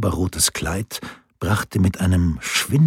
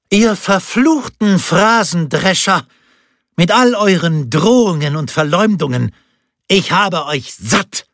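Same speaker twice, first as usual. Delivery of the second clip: ringing furious cry; 9 semitones higher than usual. A narrator's reading. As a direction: spit the contempt out so the whole square hears. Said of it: "schallte Picardas Stimme über den Kirchplatz".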